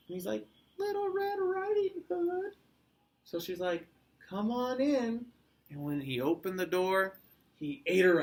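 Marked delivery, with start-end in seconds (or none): 0.8-2.5 very high, slow falsetto; drawn-out squeaky mock-girl voice; wolf imitating girl. 4.3-5.3 higher-pitched grandma voice; moderately high storybook granny; Grandma welcoming. A narrator's reading. Grandma answers the door.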